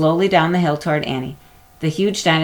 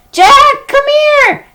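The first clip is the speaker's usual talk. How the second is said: put-on very high, loud girl's call; 11 dB louder, slower than usual. A narrator's reading. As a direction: shout for her brother from the valley. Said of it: "Annie called".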